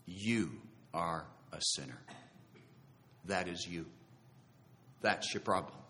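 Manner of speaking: low, hushed voice from deep within; inner voice. Retold or imitated. Voice within his heart convicting him, low and quiet. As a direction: speak softly and low, pausing between statements. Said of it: "I felt this voice".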